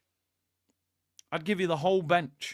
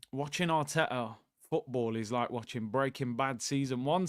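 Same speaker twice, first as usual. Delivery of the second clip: usual speaking voice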